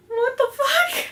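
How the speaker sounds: very high-pitched bewilderment; comic shocked disbelief; baffled outburst. Retold or imitated. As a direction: imitate a stunned, squeaky reaction. Acts out Dean's bewildered reaction.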